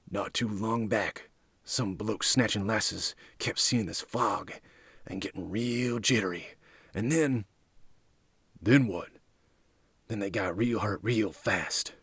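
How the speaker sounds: hushed, ominous rumour; lightly voiced storytelling thug. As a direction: tell it softly, like a campfire warning.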